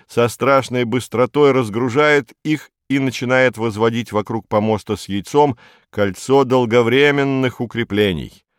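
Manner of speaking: usual speaking voice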